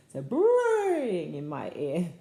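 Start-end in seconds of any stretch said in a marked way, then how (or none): 0.2-1.3 loud, stretched-out "braying"; zebra-like bray. Shown as a demonstration. Reader showing what sound a zebra makes, braying. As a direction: bray out the first word, long and loud. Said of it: "What sound does a zebra make?".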